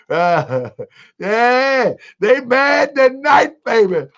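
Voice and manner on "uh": low chuckle at the chat; amused laugh breaking into the talk; real laugh. Real, not acted. Laughs at viewer comments.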